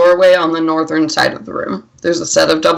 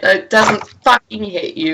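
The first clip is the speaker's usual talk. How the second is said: normal talking voice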